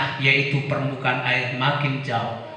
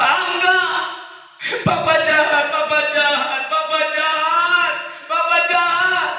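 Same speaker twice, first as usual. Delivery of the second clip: put-on child; 4 dB louder than usual. Child refuses mother.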